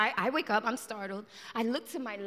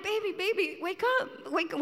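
urgent waking call; 6 semitones higher, faster than usual. Retold or imitated. Wife waking her yelling husband.

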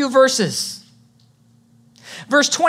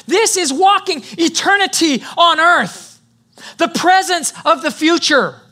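emphatic, raised pulpit proclamation; preaching at full force; 3 semitones higher, 3 dB louder than usual. Real, not acted. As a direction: drive each phrase home to the congregation.

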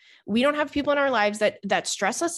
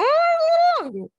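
gleeful shock; 5 dB louder than usual. Retold or imitated. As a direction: gasp then savor the words. Echoing friend with glee.

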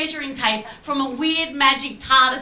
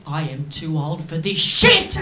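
weary resentment; 9 semitones lower, 4 dB louder, slower than usual. Retold or imitated. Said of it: "shoots him a look that says".

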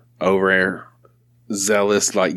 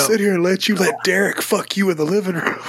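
high, overdone mock outrage; mocking jealous friend; 10 semitones higher than usual. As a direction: ham up the jealous friend's protest.

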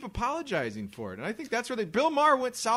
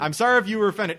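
louder, mocking fake apology; 4 semitones higher, 7 dB louder, faster than usual. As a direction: sneer out the hollow apology. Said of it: "the greatest line ever because it admits no guilt".

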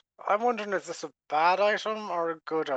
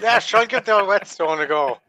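loud encouragement; 4 semitones higher, 12 dB louder than usual. Pretend. Encouraging the dagger loudly.

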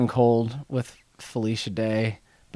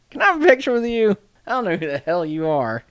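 put-on Southern accent; 11 semitones higher, 5 dB louder, faster than usual. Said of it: "You need to work on your Southern accent".

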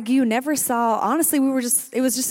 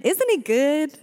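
higher, louder call-out; excited praise; 7 semitones higher, 3 dB louder, slower than usual. Real, not acted. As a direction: shout it up to the crowd. Praise after prayer.

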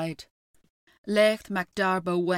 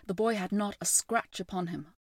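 ordinary delivery, nothing marked

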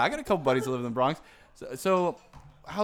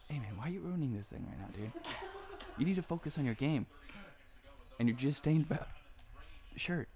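hushed scolding; 11 dB quieter than usual. Pretend. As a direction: quietly take a friend to task. Private scolding after calling Eric aside.

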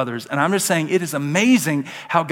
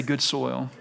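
softer, lower echo of Good soil; quiet response; 3 semitones lower, 7 dB quieter than usual. Shown as a demonstration. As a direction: say Good soil softly, dropping low. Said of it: "Everybody say".

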